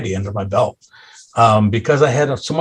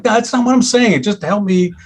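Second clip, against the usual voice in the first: higher, exasperated pleading at the waiter; frustrated retort; 8 semitones higher than usual. Retold or imitated. Dad snapping at the waiter over phone menus, exasperated and higher. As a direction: let the frustration spill out, pitched up. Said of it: "just frustrated".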